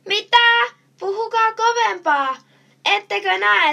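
high, very loud shout over noise. A narrator's reading. Policeman in band practice shouts at the boys, shouting. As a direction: shout as if you can't hear. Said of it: "huutaa poliisi".

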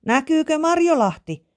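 high-pitched calling question; boy calling up. A narrator's reading. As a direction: call it up to someone high above. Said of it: "Eemeli huusi".

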